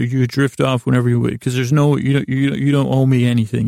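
ordinary delivery

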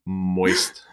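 low, playful word-relishing; teasing low voice. Pretend. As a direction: drop it very low on the disliked word.

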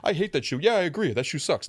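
high, loud, mocking chatter; mocking other people. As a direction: ham up a lazy pile-on. Acting out others piling on.

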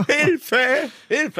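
high, hammed-up call for help; mock cry for help. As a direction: yell for help as a joke.

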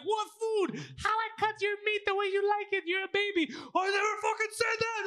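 high, outraged mock shouting; imitated yelling. Friend imitates son denying mom's story, yelling high. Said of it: "Oh, he's yelling".

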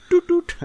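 high toot toot notification; phone alert imitation.

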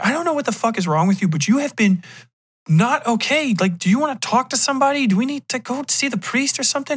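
high, exasperated pleading; arguing girlfriend. Acted. Girlfriend confronts him during an argument, exasperated.

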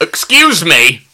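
loud, high rude Englishman abroad; rude English tourist. Pretend. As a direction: order rudely like a Brit on holiday. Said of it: "an Englishman on holiday ordering rudely".

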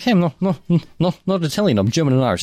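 lightly voiced Hagen protesting; high protesting Hagen. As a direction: squeak out a denial, half-joking. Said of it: "and he's like".

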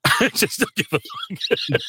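slow, high, laughing shrug-off; laughing defiance. Real, not acted. Narrator shrugging off accent critics, slow high laughing defiance. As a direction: laugh it off, not caring.

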